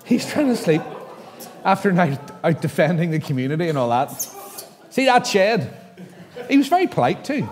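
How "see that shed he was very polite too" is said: laughing. Real, not acted. Laughing while retelling the call.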